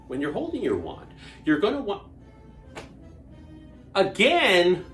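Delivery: ordinary talk, nothing marked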